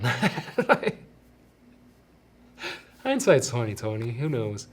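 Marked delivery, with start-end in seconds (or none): 0.0-0.9 real laugh breaking into the words. Real, not acted. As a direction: let a self-conscious laugh slip out mid-sentence. Laughs at his own parenting doubt.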